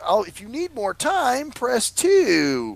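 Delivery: high, slow menu prompt; mock automated menu; phone menu voice. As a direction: read like a recorded prompt. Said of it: "If you're ready, press one".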